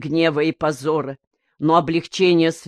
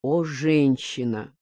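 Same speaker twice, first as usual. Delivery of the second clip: inward reflection; 3 semitones lower, 6 dB quieter than usual. A narrator's reading. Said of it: "подумал Еусин".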